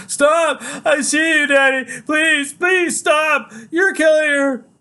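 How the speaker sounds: high, loud screamed plea; desperate scream. Acted. Child begging father to stop choking her, desperate scream. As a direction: scream at full pitch for him to stop.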